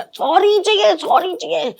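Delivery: high, repeated whiny demand; insistent Vicky imitation. Guest mimics Vicky insisting on sorry, high and whiny. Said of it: "उसमें नहीं रहा".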